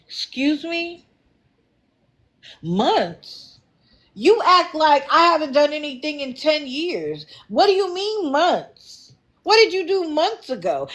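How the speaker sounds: high, incredulous challenge; indignant disbelief. Acted. Host challenging Medina's excuse of months, indignant disbelief. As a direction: question it sharply, pitch jumping up.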